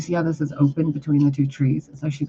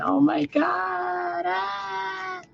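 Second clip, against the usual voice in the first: hammed-up crying shout, much higher and slow; mock panicked shriek; 4 dB quieter, much slower than usual. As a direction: squeal as if her vibration overwhelms him. Playing the emperor nearing her.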